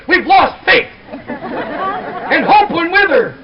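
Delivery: high, loud mock alarm over missing kids; panicked parental yell. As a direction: cry out as if a child has vanished. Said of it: "yelling at your child and yelling things like".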